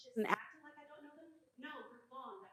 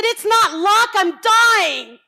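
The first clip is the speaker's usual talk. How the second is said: much higher and louder, crying out; inner outburst; 10 semitones higher, 14 dB louder, much slower than usual. Retold or imitated. Speaker voicing her unspoken reply to envious people. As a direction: blurt it out loud. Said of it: "that's what I said in my head".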